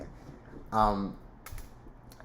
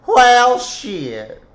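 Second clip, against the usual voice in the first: loud outburst; 13 semitones higher, 13 dB louder than usual. Real, not acted.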